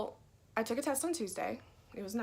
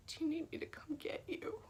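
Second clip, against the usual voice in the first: whisper; 6 semitones higher, 7 dB quieter than usual. Retold or imitated. Dad calling in crushing moments.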